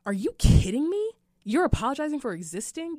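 high, loud incredulous question; as if you can't believe what you just said; loud stunned "Are you kidding me?"; shocked disbelief. Retold or imitated. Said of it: "I was like".

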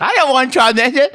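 high mock-grandma refusal; protesting grandma. Grandma refusing visitor over intercom, high comic protest. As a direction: wail it high like a stubborn grandmother. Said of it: "buzzing into my grandma's".

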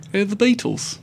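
high-pitched mock British accent. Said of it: "But when they talk".

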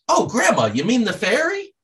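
put-on high-pitched little boy voice; grandson replying. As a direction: imitate a child innocently correcting Grandma. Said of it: "And he says".

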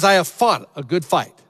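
loud triumphant proclamation; proclaiming. Acted. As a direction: declare it boldly to the crowd. Proclaiming Paul's triumphant words.

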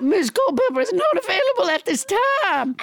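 put-on character voice. Turning away the host's request for Missus Culpepper, high put-on voice. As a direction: refuse grandly in a high voice. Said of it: "How about Missus Culpepper".

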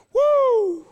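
sudden high joyful whoop; a real burst of praise, very high and loud; emotional whoop. Real, not acted. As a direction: let out an overwhelmed cry of joy. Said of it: "I'm sorry to be so emotional".

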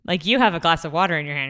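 ordinary delivery

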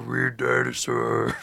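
slow, goofy dinosaur voice; weird dinosaur voice. Pretend. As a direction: drawl it like a silly dinosaur. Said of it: "is that what a dinosaur sounds like".

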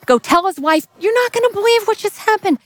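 excited servant. Acted. Amazed servant voiced.

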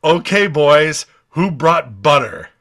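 hammed-up swaggering warrior; gruff hero quote. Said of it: "he says".

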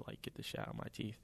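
hushed, fast muttered frustration; whispering to himself at midnight; whispered quote. Retold or imitated. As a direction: drop to a rushed whisper. Frustrated late-night self quote.

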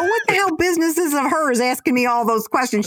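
high, annoyed outburst; complaining to Aunt Ginger after checkout; indignant complaint. Retold or imitated. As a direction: scoff at the nosy cashier. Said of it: "I go".

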